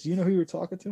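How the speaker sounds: incredulous boss. Retold or imitated.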